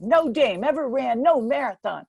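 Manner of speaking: imitating the coach blowing up; exploding coach. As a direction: bark it like an old coach losing it. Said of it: "he exploded".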